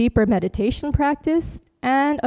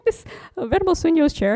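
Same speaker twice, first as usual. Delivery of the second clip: mock complaining voice; 7 semitones higher, faster than usual. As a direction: play it as a comic grumble, pitched up. Mock complaint about a deep chair.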